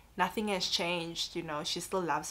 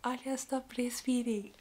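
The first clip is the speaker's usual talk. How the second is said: higher, whispery mock-emotional voice; retold whispered news; 6 semitones higher than usual. Mum telling her friend on the phone, in an acted whisper. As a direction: imitate breaking news in a choked whisper.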